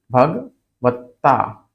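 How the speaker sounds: भगवत्ता split slowly before the double t; doubled त in भगवत्ता. Shown as a demonstration. Teacher building the word from half and full ta, slow भगवत्ता. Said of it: "आधा ता और पूरा ता".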